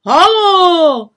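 high, loud hailing call; calling over heads. A narrator's reading. Jakub hails the flower girl over people's heads, calling loudly. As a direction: shout through cupped hands over the crowd. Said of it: "volal lidem přes hlavy".

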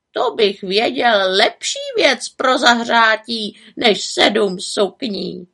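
slow, teasing raven voice; knowing raven. A narrator's reading. Raven teases the witch with a riddle, slow. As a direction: drag it out, hinting at a secret.

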